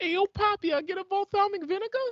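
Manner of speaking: very high, loud puppet voice with Brooklyn attitude; squeaky muppet ordering a sandwich; Elmo at a bodega. Acted. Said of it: "Elmo sound like he's ordering a sandwich at a bodega".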